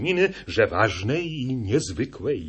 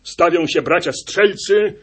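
high, loud announcement of the muster; rally the hunters like a leader; solemn proclamation; 10 semitones higher, 6 dB louder than usual. A narrator's reading. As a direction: huntsman summoning the hunters. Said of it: "rzekł".

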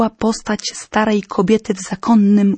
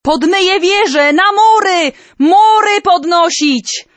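much higher frantic calls; panicked shouting; 8 semitones higher than usual. Acted. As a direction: shout orders over the crowd.

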